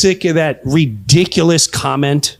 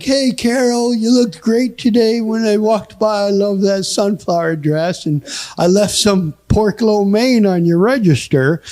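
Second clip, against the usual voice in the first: imitated old fellow on a prank call; old man; 4 semitones higher than usual. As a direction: sweet-talk her like an elderly admirer. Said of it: "pretending I was an old man".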